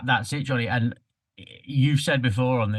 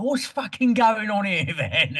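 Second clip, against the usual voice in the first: high, drawn-out mock Cockney; hammed-up Cockney dialogue, slow and high; thick Cockney; 10 semitones higher, slower than usual. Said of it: "It's very Cockney".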